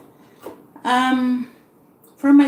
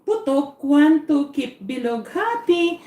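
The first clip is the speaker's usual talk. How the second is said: ordinary delivery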